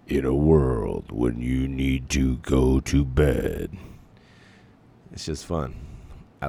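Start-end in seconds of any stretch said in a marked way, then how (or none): 0.1-3.8 slow movie-trailer announcer; drawn-out, dramatic voice-over parody; deeper voice. Shown as a demonstration. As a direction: intone it like a mock-epic trailer. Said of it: "a deeper voice for this read".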